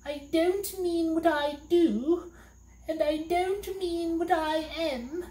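a high weepy little demon; crying. A narrator's reading. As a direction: wail it out and flee. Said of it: "crying hysterically".

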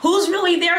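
suspicious laugh. Real, not acted.

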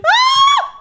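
short, very high loud scream; piercing "ah" of alarm; startled scream. Real, not acted. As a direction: shriek at a sudden threat. Startled in game.

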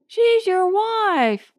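high, drawn-out disbelief; shocked protest. A narrator's reading. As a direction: protest slowly at the leader's coldness. Stunned crew protests at leader.

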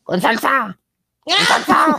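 very high, laughing shout; vendor's changed shout. Retold or imitated. Vendor shouting order after changing tone, high laughing shout. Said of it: "empecé a decir".

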